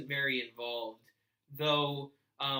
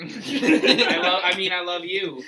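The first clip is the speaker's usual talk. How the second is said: loud laughing declaration; 4 semitones higher, 13 dB louder, slower than usual. Real, not acted. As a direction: blurt it out through laughter.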